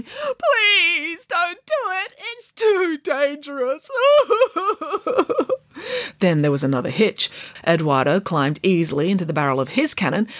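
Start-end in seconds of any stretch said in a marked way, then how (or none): 0.0-3.9 high, slow sobbing plea; sobbing bear. Acted. 3.9-6.1 high wailing sob. Acted.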